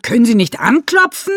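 high, loud angry yell. A narrator's reading. Renate yelling at Rockenstroh, high and loud. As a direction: snap at the intruder. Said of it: "Renate schreit ihn an".

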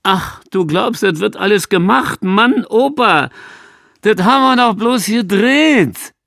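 lightly voiced amused mockery of a naive old man; mocking amusement. A narrator's reading. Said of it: "Da lacht er und sagt".

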